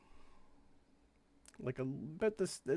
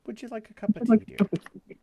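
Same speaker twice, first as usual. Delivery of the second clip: put-on higher, louder sweet old lady voice; a doddering granny offering tea; dotty absent-minded old lady; 9 semitones higher, 10 dB louder than usual. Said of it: "deity offers tea".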